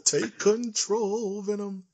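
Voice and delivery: hammed-up high echo with a laugh; mock high voice. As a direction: copy the squeaky voice back. Echoing friend's voice, laughing.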